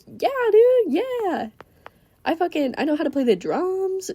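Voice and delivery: high-pitched, bubbly enthusiasm; eager. Acted. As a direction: jump at the question in a high voice. Acting eager girl asked about instruments.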